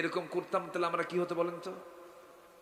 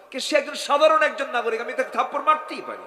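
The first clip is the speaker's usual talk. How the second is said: higher, louder haughty king; arrogant king; 8 semitones higher, 9 dB louder than usual. Acted. King defending his slap before Omar. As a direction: sneer it like a proud king. King's arrogant reply voiced.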